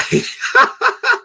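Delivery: a sudden squeal of real laughter; high burst of laughter. Real, not acted. Laughing at the kidnap joke.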